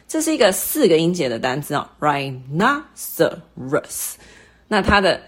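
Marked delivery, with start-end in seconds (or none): 2.0-4.1 rhinoceros in separate beats, NO raised; stress on second syllable. Shown as a demonstration. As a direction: split rhinoceros and lift syllable two. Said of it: "音调比较提高的部分是在第二个音节".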